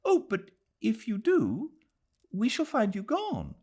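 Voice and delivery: a sudden, earnest dismay; dismayed cry. Acted. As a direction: realize it mid-sentence and cry out. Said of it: "Then she cried".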